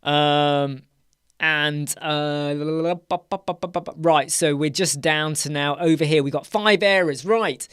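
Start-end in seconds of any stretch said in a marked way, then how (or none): 2.6-3.9 tongue-rolling "la la la" into popping "ba ba ba"; absent-minded babble; idle vocal filler. Real, not acted. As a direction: burble nonsense syllables while thinking. Nonsense syllables while looking at screen.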